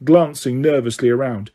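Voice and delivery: usual speaking voice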